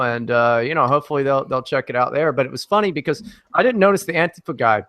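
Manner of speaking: normal talking voice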